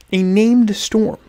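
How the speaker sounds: slow and pointed; emphatic. Real, not acted. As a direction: lean on each word slowly.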